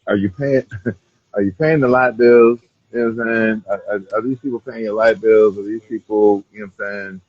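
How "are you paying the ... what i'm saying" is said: ordinary delivery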